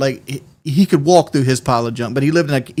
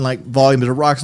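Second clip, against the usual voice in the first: usual speaking voice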